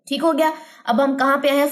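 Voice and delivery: ordinary delivery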